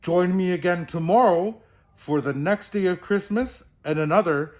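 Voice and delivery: big, merry Santa voice; jolly Santa host. Acted. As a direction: bid listeners back as a booming Santa. Santa signs off.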